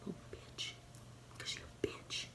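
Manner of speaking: hushed taunting whisper; whispered insult. Acted. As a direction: sneer it in a whisper. Trash-talking the virus.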